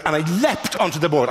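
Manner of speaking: usual speaking voice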